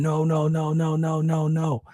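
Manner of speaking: ordinary talk, nothing marked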